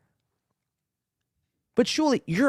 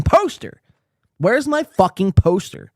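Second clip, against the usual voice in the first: worked up; 5 semitones higher, slower than usual. Real, not acted. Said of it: "I'm getting very worked up".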